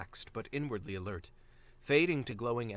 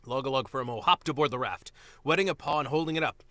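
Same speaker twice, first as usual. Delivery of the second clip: ordinary delivery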